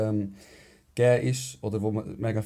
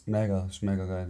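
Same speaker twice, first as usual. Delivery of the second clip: ordinary delivery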